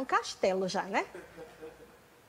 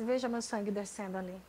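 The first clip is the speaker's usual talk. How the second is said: voicing God softly; 4 semitones lower, 8 dB quieter than usual. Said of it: "Ele disse".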